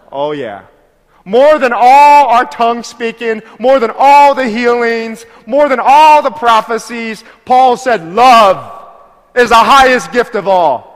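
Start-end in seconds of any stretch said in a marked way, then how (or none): 1.2-10.9 much louder, slow, hammering each line; preacher thundering that love beats every gift; full-force preaching. Acted. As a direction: build it like a pulpit climax.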